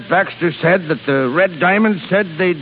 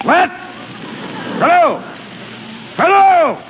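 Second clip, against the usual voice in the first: high, rising bellow into a dead line; outraged phone shout; shouting hello; 11 semitones higher than usual. Acted. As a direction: holler into the phone, more frantic each time.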